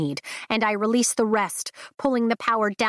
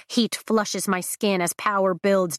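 usual speaking voice

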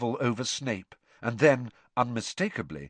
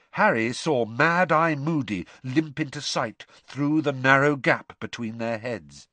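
plain reading voice

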